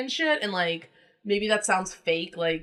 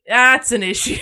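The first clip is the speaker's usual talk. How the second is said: usual speaking voice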